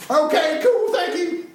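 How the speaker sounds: very high squeaky reply; prairie dog voice. Retold or imitated. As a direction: chirp it like a tiny rodent. Prairie dogs answering.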